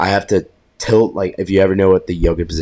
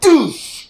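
impact sound effect. Retold or imitated.

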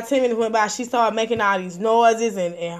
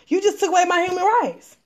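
higher-pitched, indignant protest in the victim's place; outraged, high-pitched accusation; 6 semitones higher than usual. Acted.

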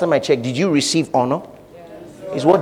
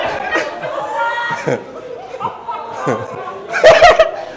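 high laugh. Real, not acted. Big laugh at own joke.

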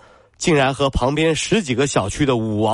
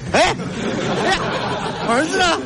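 mock panic; 14 semitones higher, faster than usual. Acted panic over missing son.

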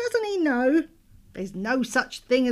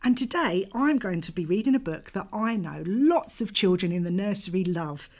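put-on overbright kids party host; 3 semitones higher, 4 dB louder than usual. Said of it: "The Gruffalo by Julia Donaldson".

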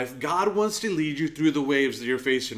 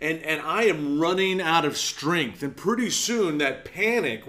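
ordinary delivery

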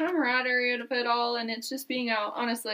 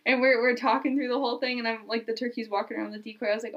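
ordinary talk

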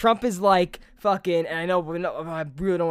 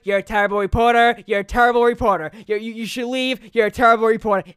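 mock angry; 2 semitones higher, 6 dB louder, faster than usual. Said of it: "he was like".

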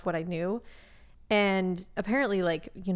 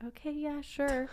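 reluctant owner; 6 semitones higher, 7 dB quieter, slower than usual. Retold or imitated. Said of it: "people are like".

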